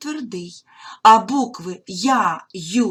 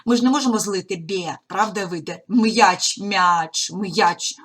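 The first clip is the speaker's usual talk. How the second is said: ordinary talk, nothing marked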